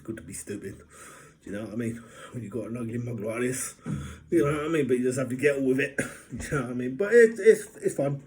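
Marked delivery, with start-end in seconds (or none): none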